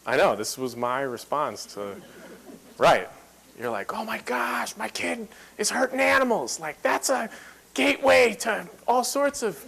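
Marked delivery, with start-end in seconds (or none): 3.9-9.6 high, hushed mock panic; panicked inner voice. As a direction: imitate your own alarm as a parent, pitched up and quiet. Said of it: "flipping out".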